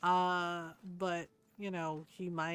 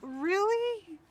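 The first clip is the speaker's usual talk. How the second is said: incredulous; 12 semitones higher, 5 dB louder than usual. Retold or imitated. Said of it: "I'm like".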